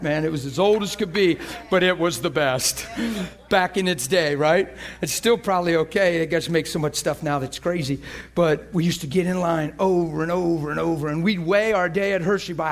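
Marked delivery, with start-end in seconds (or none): none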